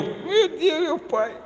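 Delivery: put-on a boy caught off guard; embarrassed kid seeing his dad at the window; startled child. Child shocked at father's shout.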